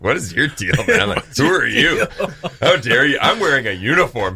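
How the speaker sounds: mock-indignant teen sass; sassy talk-back. Teen sassing the head of college, mock indignant. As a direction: snap back like an offended teenager. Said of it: "That's how I was talking to him".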